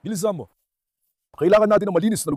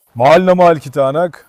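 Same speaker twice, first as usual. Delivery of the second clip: loud; 3 semitones lower, 5 dB louder than usual. Real, not acted. Praising the dog.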